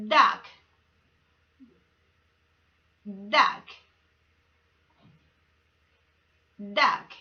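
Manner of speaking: "duck" said loud and slow, with gaps; pronunciation drill. Shown as a demonstration. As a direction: model "duck" for kids to echo. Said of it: "Let's begin with".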